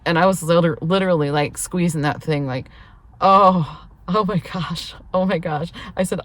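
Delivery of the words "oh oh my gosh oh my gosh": acting out straining to hold it; loud "oh", then easing off; retold strained moaning. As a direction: re-enact squeezing through discomfort. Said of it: "I know I sound absolutely ridiculous".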